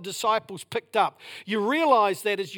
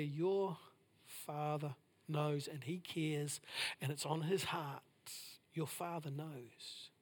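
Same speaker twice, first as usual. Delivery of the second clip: much softer and slow, fading to a hush; hushed slow comfort; 6 semitones lower, 15 dB quieter, slower than usual. Acted. Preacher quietly comforting listeners in prayer. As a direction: let each word rest gently. Gentle repeated assurance.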